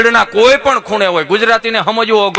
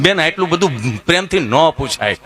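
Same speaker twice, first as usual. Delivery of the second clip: low, dragged-out stammering answer; 5 semitones lower than usual. Retold or imitated. To the nurse, Gabho answers his name in a low stammer.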